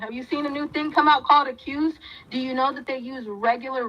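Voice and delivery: usual speaking voice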